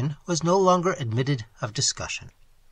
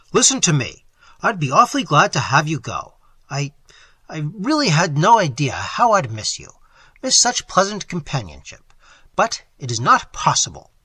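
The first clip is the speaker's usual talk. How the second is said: put-on louder, insistent young man; firm young man; 2 semitones higher, 8 dB louder than usual. A narrator's reading. Employer insisting to his stenographer, louder and firm. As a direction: press the point on her, firmly. Man voiced firmly in dialogue.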